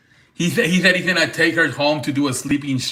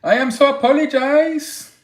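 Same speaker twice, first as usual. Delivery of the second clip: put-on Turkish accent; 11 semitones higher than usual. Imitating the Turkish streamer apologizing to store staff, high mock Turkish accent. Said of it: "so he does an accent".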